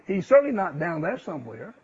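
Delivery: normal talking voice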